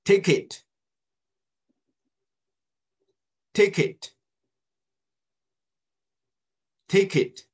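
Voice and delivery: 逐词领读，字字清楚，声音洪亮; 老师示范单词，让学生跟读; 领读示范. Shown as a demonstration. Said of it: "Read after me". (领读单词，清楚洪亮)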